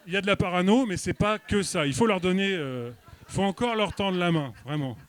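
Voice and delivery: normal talking voice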